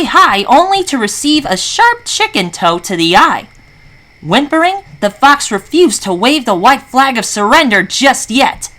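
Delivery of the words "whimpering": plain reading voice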